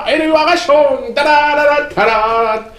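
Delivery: high sing-song tada fanfare; excited announcement. Acted. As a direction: sell the surprise like a showman. Announcing surprise to cat.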